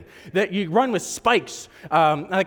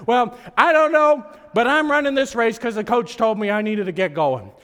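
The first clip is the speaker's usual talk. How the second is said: normal talking voice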